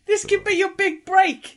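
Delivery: high, loud mock cheer; mock-thrilled high loud congratulation; mock excitement. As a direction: hype up the sobbing-man role sarcastically. Teasing a friend's tiny role.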